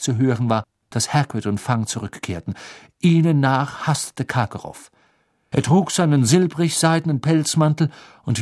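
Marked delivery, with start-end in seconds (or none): none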